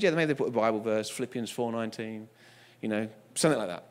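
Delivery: low, soft offhand voice; imitating an awkward, reluctant man; hesitant suggestion. Same customer suggests a verse.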